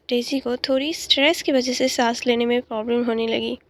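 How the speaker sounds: plain reading voice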